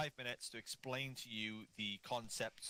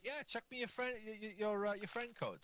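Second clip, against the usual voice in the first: mock Irish reply; 11 semitones higher than usual. Voicing Irish player.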